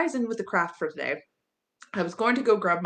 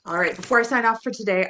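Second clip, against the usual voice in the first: ordinary delivery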